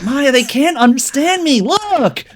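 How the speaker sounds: put-on high, pleading American accent. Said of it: "I spoke with the American accent".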